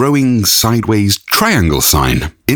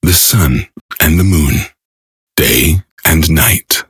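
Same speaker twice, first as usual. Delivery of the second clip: epic narration; 7 semitones lower than usual. Acted. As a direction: intone it like a film trailer.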